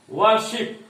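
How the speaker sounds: "Worship" with the weight on शिप; second-part stress. Shown as a demonstration. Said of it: "शिप पर ज्यादा जोर है".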